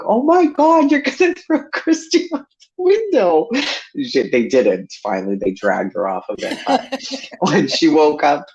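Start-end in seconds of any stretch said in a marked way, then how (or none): 0.0-3.5 high, loud and far alarmed squeal; alarmed high cry. Retold or imitated. Kid shrieking at uncles carrying the mattress, high and alarmed. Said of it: "And I'm like".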